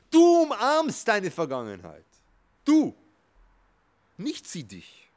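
high and loud, stressing you; emphatic shout. Real, not acted. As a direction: drive the point home at full voice.

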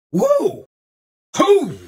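high, loud cowboy whoop; excited whoops. Acted. Cowboy whooping in triumph, loud and high. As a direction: let out a yeehaw-style holler.

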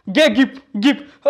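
high, loud thwacks acted out in words; mimicking a thrashing, hit by hit; imitated blows. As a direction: punch out each blow like a sound effect.

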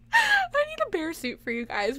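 high, tearful laughing; laugh-crying. Real, not acted. Streamer laughing at the idea of bear suits. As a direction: squeak it out through laughter.

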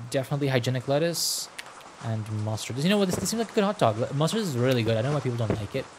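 normal talking voice

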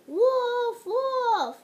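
high loud barks; dog bark imitation. Dog barking.

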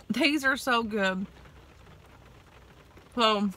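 talking while eating pastry; biting food mid-sentence; mouth full chewing. Real, not acted. Seen 1: Holds pastry at mouth, biting and chewing throughout the words.

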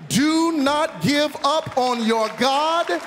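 high, slow, drawn-out exhortation; impassioned preaching. Real, not acted. Preacher urges the congregation to trust God, exhorting. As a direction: stretch each phrase out to rally the church.

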